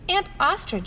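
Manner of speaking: put-on high, eager little child voice; little elephant asking her aunt; curious elephant child. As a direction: pipe it up like a small curious calf.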